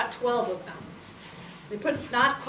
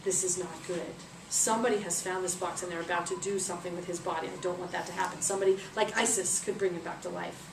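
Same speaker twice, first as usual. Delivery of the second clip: scheming villain; 4 semitones lower, 6 dB quieter than usual. Acted. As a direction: murmur the plan like a schemer. Villain Set plots quietly.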